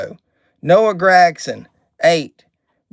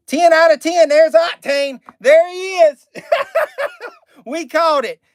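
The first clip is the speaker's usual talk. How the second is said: thrilled high yelps with a laugh; real joy, pitch way up; excited shout; 11 semitones higher than usual. Real, not acted. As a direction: burst out with delight at the pull. Pulling the hoped-for card.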